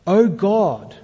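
understated prayerful cry. Acted. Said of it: "Moses cried out to the Lord".

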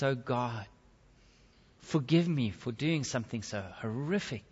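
low, soft plea for forgiveness; grieving prayer. Acted. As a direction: pray quietly, with understated grief. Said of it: "in grief and he prays".

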